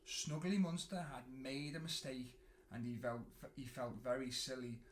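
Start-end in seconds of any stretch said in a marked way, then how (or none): none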